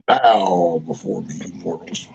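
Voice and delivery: low, slow, mock-villain decree; dark lord. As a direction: play a hammy dark overlord demanding worship. Playing queen of bowing hens.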